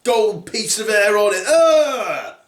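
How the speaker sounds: high-pitched revolted outburst; Daddy Bear. Acted. Father bear realising about his toothbrush, disgusted. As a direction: wail in mock disgust. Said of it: "said Daddy Bear".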